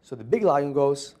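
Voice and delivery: ordinary delivery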